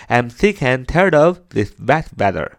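"sick and tired of" run together; linked speech. Shown as a demonstration. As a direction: link "sick and" and "tired of" without gaps. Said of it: "这就是连读的时候". (把"sick and tired of"连读)

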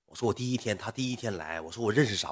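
retold soothing. Streamer placating a jealous supporter, soft coaxing. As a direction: play it down softly. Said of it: "我就哄".

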